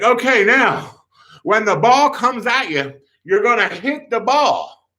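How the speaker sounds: high, emphatic mock instruction; temperamental coach. Coach instructing the kid how to hit, temperamental. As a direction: spell out the order in a high imitated yell. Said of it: "temperamental director, calm down".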